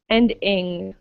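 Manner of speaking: "ing" said with a nasal ng close; teacher contrasts "ing" with "E"; ending ing. Shown as a demonstration. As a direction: stress the "ing" ending against the ee. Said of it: "between the ending".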